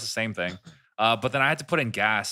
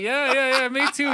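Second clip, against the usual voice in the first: high, loud, rapid-fire agreement; eager playing along; 7 dB louder than usual. Playing along with the gas station guy, over-eager.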